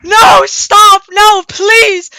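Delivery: high, loud desperate pleas; panicked screaming. Retold or imitated. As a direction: scream for her to stop. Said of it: "May's like".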